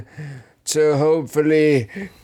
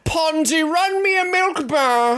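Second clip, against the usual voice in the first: put-on high, hammy old-timey patron; 11 semitones higher than usual. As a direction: call for your drink in a silly period voice. Old-timey tavern bit.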